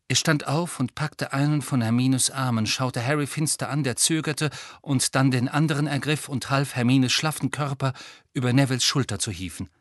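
ordinary delivery, nothing marked

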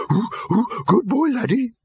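very high doggy yelps; high-pitched talking-dog bark; dog barking words. A narrator's reading. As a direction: woof first, then yap the words high. Same barking dog heard again.

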